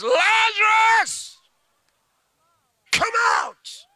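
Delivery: very high, long drawn-out shout; loud cry. Acted. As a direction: call the dead man out of the tomb. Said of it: "cried with a loud voice".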